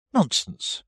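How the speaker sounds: high, firm retort; loud and decided. A narrator's reading. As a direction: cut in decidedly, pitched right up. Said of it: "said Alice very loudly and decidedly".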